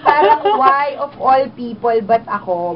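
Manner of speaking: loud, high complaint to God; indignant protest. Retold or imitated. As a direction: call up to the Lord in outrage.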